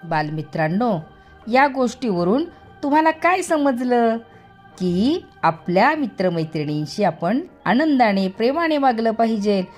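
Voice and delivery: ordinary delivery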